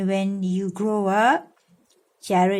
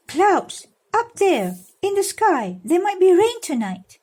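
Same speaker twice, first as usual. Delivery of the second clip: rock keeper's voice; 10 semitones higher, 3 dB louder than usual. A narrator's reading. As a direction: announce the coming rain as the old rock keeper. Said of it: "said the man who looked after the rock".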